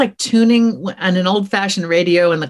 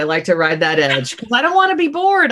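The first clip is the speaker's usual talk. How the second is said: ordinary talk, nothing marked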